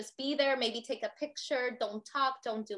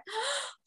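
sharp gasp; 13 semitones higher, 4 dB quieter than usual. Retold or imitated. Husband sees flooding.

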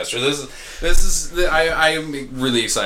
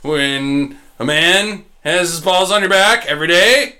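ordinary talk, nothing marked

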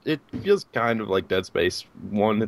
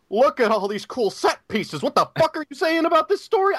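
mock clueless gamer. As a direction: act dazzled, then lost. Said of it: "So I'm like".

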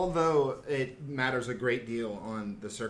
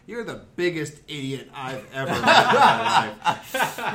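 put-on old man's blunt laughing put-down, louder; a hint of a laughing old stranger; 3 semitones higher, 11 dB louder than usual. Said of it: "And the guy said".

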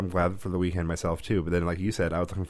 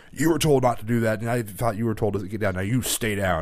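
put-on louder, stern scary-man boom at the kid; 3 semitones higher, 5 dB louder than usual. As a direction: boom it out to scare a child off the wall. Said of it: "this really loud, booming voice".

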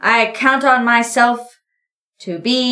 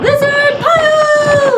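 high, drawn-out shouted cry; playful shout; 7 dB louder, slower than usual. Acted. Player joining the sibling tackle, long high shout. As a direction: yell it back gleefully.

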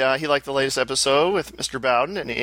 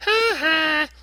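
mock laugh. Mocking the other's laugh.

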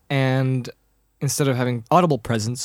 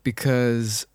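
usual speaking voice

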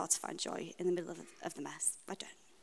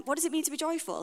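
mock asker's question; 8 semitones higher, faster than usual. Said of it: "if you'd asked me".

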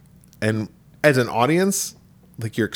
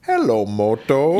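drawn-out Hello Moto; slow ringtone voice; slower than usual. Retold or imitated. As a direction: ringtone jingle, slowly. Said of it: "the Motorola ringtone".

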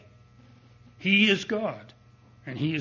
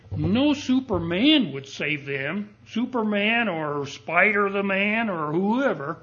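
rising louder with each line; building preaching; 4 semitones higher, 5 dB louder than usual. Real, not acted. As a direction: build the list to a ringing climax. Building sermon list to climax.

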